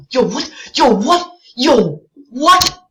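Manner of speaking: high, loud shocked yelling; real shocked outcry. Real, not acted. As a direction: yell in disbelief at the screen. Shocked at game event.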